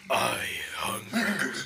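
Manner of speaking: slow, booming cosmic villain impression; Galactus voice. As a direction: say it slowly like a giant cosmic villain. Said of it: "I was going for Galactus".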